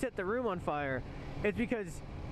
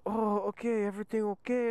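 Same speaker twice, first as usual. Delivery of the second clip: higher-pitched older Korean man, lightly imitated; imitated Korean accent; 4 semitones higher than usual. Older Korean man walks in to check, imitated Korean accent. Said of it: "an older Korean man comes up".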